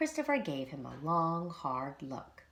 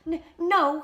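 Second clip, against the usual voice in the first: high, loud stammered no through tears; sobbing; 12 semitones higher, 10 dB louder than usual. A narrator's reading. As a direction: choke out the denial as you cry. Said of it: "he sobbed".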